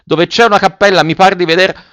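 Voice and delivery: high, loud sustained call; shouted report. A narrator's reading. Boy leaning from the tree to report, shouting. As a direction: call it far, pitched well up. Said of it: "gridò il ragazzo".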